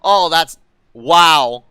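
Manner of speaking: loud stunned gasping disbelief; breathless shock at the crash; shocked laughter. Real, not acted. Wreck unfolding.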